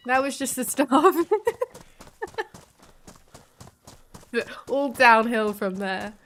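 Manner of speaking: loud burst of laughter mid-line; laughing. Real, not acted. Streamer laughing at being double raided, loud laugh. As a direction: laugh it off, then deadpan.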